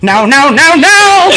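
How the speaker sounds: rising cartoon panic cry; Cleveland Brown. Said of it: "more like Cleveland Brown".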